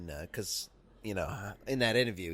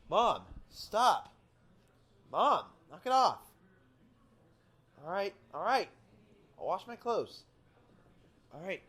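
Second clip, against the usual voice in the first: high, strained call to mom in another room; a grown man calling back to his nagging mom; 12 semitones higher than usual. Acted. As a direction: pitch way up, yelling across the house. Yelling up at mom mid-show.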